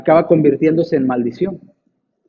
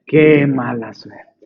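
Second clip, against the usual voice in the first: mock lament; 5 semitones lower, much slower than usual. Neighbours lament bad luck.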